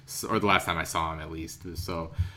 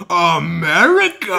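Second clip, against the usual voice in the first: loud, high, slow drawn-out question; incredulous America; 8 semitones higher, 12 dB louder, slower than usual. Retold or imitated. Outback mechanic hearing America, loud amazed question. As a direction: stretch it in amazement. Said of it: "And he's like".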